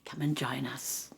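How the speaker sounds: soft, whispery meditation voice; guided meditation. Acted. Teacher invites listeners to feel gratitude: soft meditation voice. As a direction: draw them gently inward.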